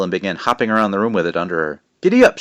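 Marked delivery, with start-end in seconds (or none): 2.0-2.4 high, loud playful giddy up; shouted. A narrator's reading. May hopping on the broom, playful shout. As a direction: shout it like riding a horse. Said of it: "she shouted".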